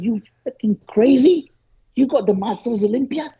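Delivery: high, animated mock scolding; excited retold rant. Coach scolding an athlete about the Olympia, high and animated. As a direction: imitate the coach pushing him hard, voice up high. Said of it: "He said".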